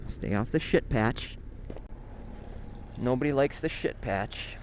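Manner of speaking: normal talking voice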